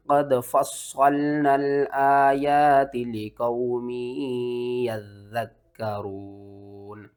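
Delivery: slow tilawat with long held vowels and doubled letters; measured, sustained Arabic recitation; Quran recitation. Shown as a demonstration. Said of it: "इस आयत को तिलावत पहले कर लेते हैं".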